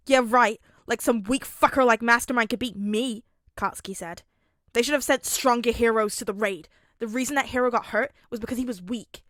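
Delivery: loud, cocky, dismissive boast; loud cocky teen. A narrator's reading. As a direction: brag like nobody could touch you.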